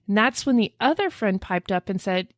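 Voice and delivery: ordinary talk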